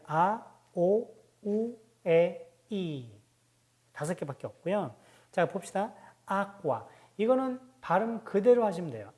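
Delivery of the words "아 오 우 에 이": 아, 오, 우, 에, 이 drawn out one by one; slow vowel list. Shown as a demonstration. Teacher slowly sounding the five Italian vowels. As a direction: stretch each vowel slowly. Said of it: "어떤 모음이 있냐면".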